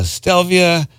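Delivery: slow, hammed-up Italian accent on the name; bad Italian accent. Radio DJ mangling a composer's name, mock Italian accent. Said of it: "my bad Italian accent".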